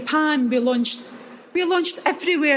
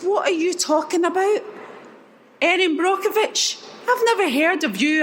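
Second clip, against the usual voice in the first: incredulous disbelief; 6 semitones higher than usual. Retold or imitated. Retold shock at a surprise phone call.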